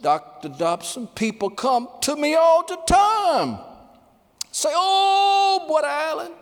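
very high, slow, strained imitation of his words; Alan Oggs' speech. Preacher quoting Alan Oggs to Dobson, high and strained. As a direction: stretch it high and slow as he did. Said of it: "He said it like this".

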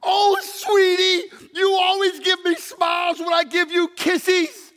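high, slow, voice breaking with tears; preacher quoting husband tearfully to wife; tearful tender. Retold or imitated. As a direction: speak it through tears to her. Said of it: "He said".

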